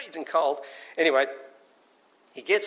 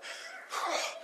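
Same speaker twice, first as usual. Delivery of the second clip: sigh of relief; 12 dB quieter than usual. Acted. Reaching the bank safely.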